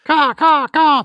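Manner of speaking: very high, loud crow caws; bird caw. Acted. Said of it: "Quiet, dust pigeon".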